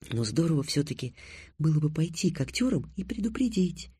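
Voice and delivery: put-on soft, musing girl's voice; thoughtful. A narrator's reading. Тиана thinks of warning the actors, softly pensive. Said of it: "задумчиво сказала Тиана".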